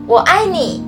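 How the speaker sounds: bright shouted line, little rabbit; shouting. Acted. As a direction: call it out to the whole forest. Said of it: "小兔子大叫".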